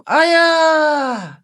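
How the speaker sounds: high, drawn-out call; a boy calling after his falling father; child's cry. Acted. Son crying out for his father, high and long.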